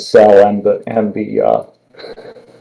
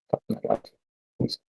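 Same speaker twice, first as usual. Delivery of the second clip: muttered under breath; 14 semitones lower, 17 dB quieter, slower than usual. Real, not acted. Frustrated muttering over lost team.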